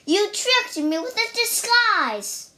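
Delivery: high-pitched accusing bear; outraged bear, voice pushed up high; indignant cry. A narrator's reading. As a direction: cry out as if cheated. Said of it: "the bear cried".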